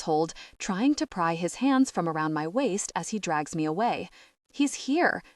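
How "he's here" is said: high yelled reply; Danny yells back from the rubble, high; yelling. Acted. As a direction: call it out from the rubble. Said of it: "Danny yells".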